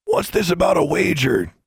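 lightly voiced gruff man; a hint of a stern older man; deep stern voice. A narrator's reading. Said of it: "Then a deep voice said".

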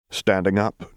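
ordinary delivery

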